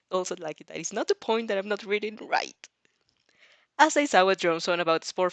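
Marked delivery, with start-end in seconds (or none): none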